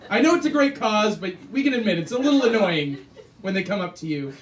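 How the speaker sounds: ordinary talk, nothing marked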